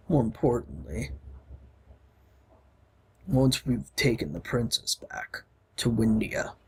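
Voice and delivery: deep hero voice. Acted. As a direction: speak as a big, steady warrior. Reading Gar's lines in-game.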